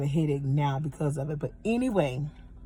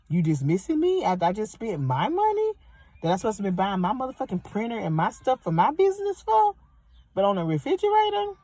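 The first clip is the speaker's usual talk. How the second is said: higher, incredulous rising questions; indignant disbelief; 6 semitones higher than usual. Real, not acted. Venting about the store dismissing her, indignant. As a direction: protest the dismissal, pitch climbing sharply.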